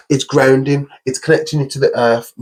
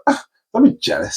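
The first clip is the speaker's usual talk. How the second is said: teasing high voice; 13 semitones higher, faster than usual. Retold or imitated. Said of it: "I'm like".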